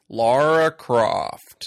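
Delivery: slow, loud, overdone American drawl of "Lara Croft"; bad American accent. Mocking Daniel Craig's fake American accent, slow and loud. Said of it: "sounded like Harrison Ford making fun of Harrison Ford".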